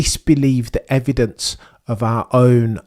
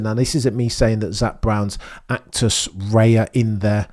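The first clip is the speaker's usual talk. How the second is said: ordinary delivery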